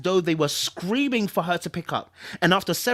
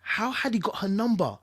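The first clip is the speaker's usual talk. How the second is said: usual speaking voice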